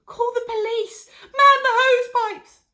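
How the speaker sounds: high, loud, slow panicked cry; cried out. Acted. Missus Cranky crying out as her mother steams. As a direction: scream for help in a panic. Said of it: "cried Missus Cranky".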